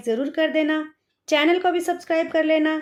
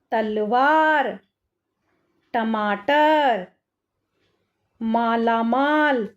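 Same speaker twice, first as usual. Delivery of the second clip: फलाहार read slowly, each syllable clear; slow reading aloud; 3 semitones lower, much slower than usual. Shown as a demonstration. Teacher reading a word to children, slow and clear. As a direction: spell it out slowly for the class.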